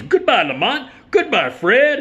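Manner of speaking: very high, loud hasty goodbye as Melvin; Melvin farewell. Retold or imitated. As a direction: bail out with a shrill rushed goodbye. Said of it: "turns right around and goes".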